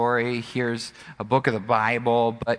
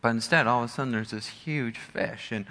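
usual speaking voice

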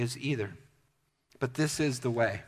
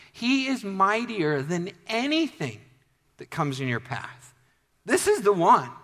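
raised, emphatic preacher's delivery; impassioned preaching; 5 semitones higher, 3 dB louder than usual. Acted. Preacher assuring the congregation of the one who can, impassioned. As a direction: build with conviction and punch the key words.

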